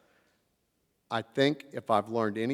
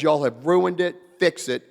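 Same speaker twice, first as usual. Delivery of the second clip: loud, raised final order; retold scolding; 5 semitones higher, 7 dB louder than usual. Guest ends his tirade at the delegates, loud scolding command. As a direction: slam the verdict down. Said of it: "And then he says".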